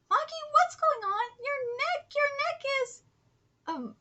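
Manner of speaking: high-pitched startled concern; acted alarm. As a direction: sound alarmed for your friend, pitched high. Character alarmed at friend's neck.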